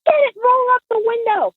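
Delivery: very high, urgent mock panic; freaked-out dad. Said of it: "My dad freaked out".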